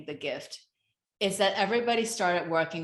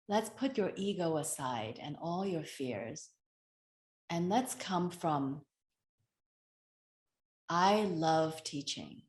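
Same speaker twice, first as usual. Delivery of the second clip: calming self-talk; 2 semitones lower, 6 dB quieter than usual. Retold or imitated. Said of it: "I just remind myself".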